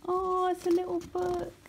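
mother opening her son's present; delighted surprise. Real, not acted. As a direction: open it like a treasure. Opening child's gift.

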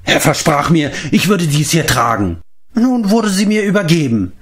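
high, rapturous madman; crazed usurper. A narrator's reading. Mark to himself over the crown, crazed. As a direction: savour the promised crown.

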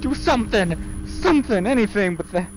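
high, loud frantic yell; panicked yelling. Acted. Gamer yelling at a ghost to go away, a hammed-up frantic shout.

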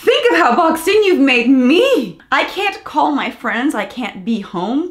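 high, slow mocking whine of the husband. Mocking the husband's complaint to viewers, in a high slow whine. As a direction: parody his self-pity, stretched out and high.

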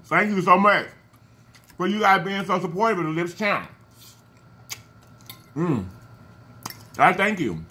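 words muffled by food; talking while chewing. Real, not acted. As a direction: mouth full of salad. Seen 2: fork of salad into mouth at 2.0, chews while talking after.

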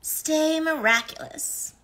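high, slow cartoon catchphrase; Tikki voice. Signature catchphrase in character.

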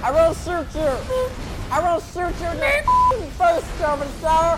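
hammed-up killing frenzy, yelling threats; manic yelling. Gamer yelling threats at zombies, manic shout.

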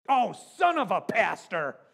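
loud, slow exasperated cursing; mock angry expletive. Pastor imagines his name used as a curse, mock-angry shout. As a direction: blurt it out like a swear word. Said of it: "as an explicative when you were angry".

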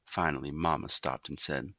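ordinary delivery

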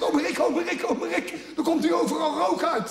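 high, loud panicked squeal; squealing like a pig. Retold or imitated. Nephew squeals to his uncle as his computer smokes, high and panicked. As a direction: shriek for uncle like a stuck pig. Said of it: "begon hij te schreeuwen als een speenvark".

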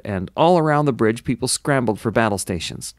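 ordinary delivery, nothing marked

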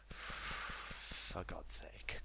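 sighing, unvoiced whisper; exasperated. Real, not acted. Gamer moving himself along, exasperated whisper. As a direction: sigh it out in a whisper.

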